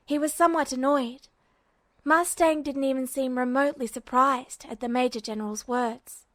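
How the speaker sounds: ordinary delivery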